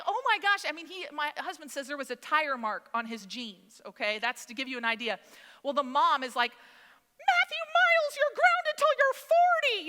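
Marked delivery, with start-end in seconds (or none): 0.1-0.6 high, loud acted outcry; mock screaming. Boy run over a second time, mock screaming. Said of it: "So he is screaming like". 6.5-9.9 very high, loud, drawn-out mock scolding; huffy mom. Mom to son who ran over his brother, huffy yelling. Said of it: "like, all huffy".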